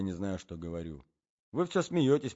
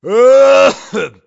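put-on old man's cough; 14 semitones higher, 21 dB louder than usual. A narrator's reading. Old man coughing in bed.

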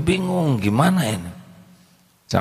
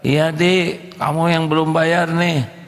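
teacher voice; 7 semitones higher than usual. Retold or imitated. Preacher voices the schoolteacher.

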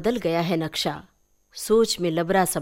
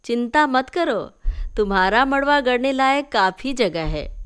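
higher, louder teasing; teasing aunt; 6 semitones higher, 4 dB louder than usual. Acted. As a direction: tease her about the wedding. (चाची की ऊँची चिढ़ाती आवाज़)

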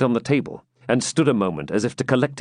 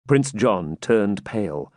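ordinary delivery

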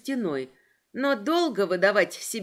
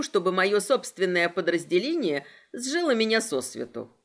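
plain reading voice

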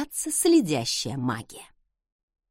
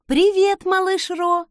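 high, louder, put-on cheery greeting; falsely cheerful; 10 semitones higher, 6 dB louder than usual. A narrator's reading. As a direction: greet the child through a fake smile. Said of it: "Я растянула губы в фальшивой улыбке".

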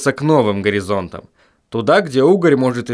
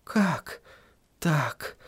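much softer puzzled question; soft confused; 3 semitones higher, 11 dB quieter than usual. A narrator's reading. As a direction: ask it quietly, bewildered.

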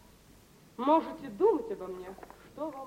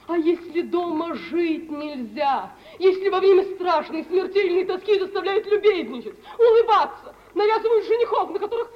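higher, louder, laughing with despair; bitter hysterical laugh; 7 semitones higher, 8 dB louder than usual. Acted. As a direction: spill it out with a desperate, broken laugh. Лариса с надрывом о своей жизни. (истерический смех сквозь отчаяние)

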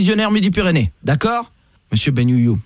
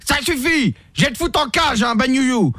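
pitched-up mock-angry cop; yelling; 8 semitones higher than usual. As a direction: bark it high like a cop losing patience. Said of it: "Et pourquoi vous me criez dessus?".

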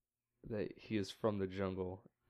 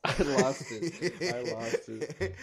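laughing; 2 semitones higher, 4 dB louder, slower than usual. Real, not acted. Laughing retelling.

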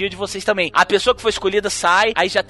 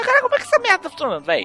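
much higher, fast, incredulous squeak; amazed disbelief; 12 semitones higher, much faster than usual. Retold or imitated. Reacting to the talking goblin, high fast disbelief. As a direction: blurt it out in baffled wonder.

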